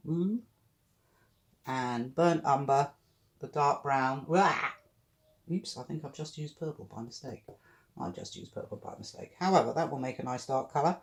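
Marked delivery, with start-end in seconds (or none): 4.3-4.5 startled yelp. Real, not acted. Sudden paint mistake. 8.0-9.3 soft guilty whisper under breath. Real, not acted. Repeats mistake remark, then whispers.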